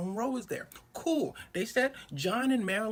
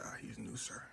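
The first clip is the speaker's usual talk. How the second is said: hushed whisper to the boss; whispered excuse; 7 semitones lower, 11 dB quieter than usual. Retold or imitated. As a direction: whisper it, covering a mistake. Staffer covering for the slip.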